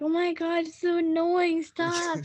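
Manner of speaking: whiny and fed up; annoyed parent. Retold or imitated. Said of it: "my parents are just like".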